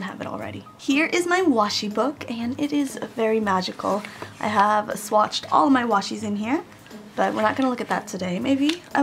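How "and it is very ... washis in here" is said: usual speaking voice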